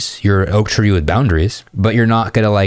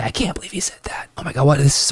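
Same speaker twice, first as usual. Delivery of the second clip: breathless unvoiced girl whisper; imitating a girl whispering in disbelief; 6 semitones higher, 5 dB quieter, faster than usual. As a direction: whisper it like shocked gossip. Imitating women gossiping.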